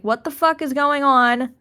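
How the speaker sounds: loud in-character cry of alarm; acted scream. Player voices her lost character in the void, acted scream. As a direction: raise the voice in a half-scream. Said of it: "she's gonna kinda scream".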